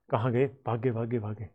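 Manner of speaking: low, soft self-talk while searching; muttering to himself. Real, not acted. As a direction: trail off quietly, as if only to yourself. Searching for lost page, self-talk.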